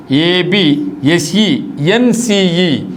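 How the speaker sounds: very slow, letter by letter; slow spelling out. Shown as a demonstration. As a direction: spell ఏబిఎస్ఈఎన్సిఈ out slowly so each letter lands. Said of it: "not SE, only సిఈ".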